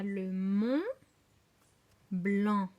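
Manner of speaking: "Mont" held and rising, pause, loud "Blanc"; deliberate, punched "Blanc" after a stretched "Mont"; slow sounding-out. Shown as a demonstration. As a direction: separate "Mont" from "Blanc" with a long gap. Teacher sounding out each word.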